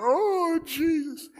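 hammed-up wail, pitched very high; mock sobbing. Hypocrites weeping in the pews, mock high wailing. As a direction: imitate a hypocrite bawling at the baptistry. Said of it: "crying their eyeballs out".

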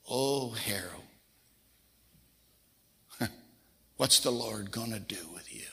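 grandmother crying softly, words breaking into a whisper; hushed and teary, half laughing through it; tearful whisper. Retold or imitated. Grandmother to grandson, a quiet tearful whisper. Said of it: "And then she started to cry".

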